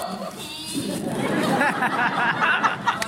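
rising burst of laughter. Real, not acted. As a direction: laugh out loud at the memory. Laughs at own retold scene. (risa fuerte)

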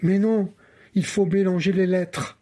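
ordinary delivery, nothing marked

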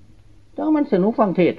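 high, slow, loud mocking quote; quoted villager. Monk quoting a villager's teasing remark, high and slow. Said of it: "มันว่า ว่าซั่น".

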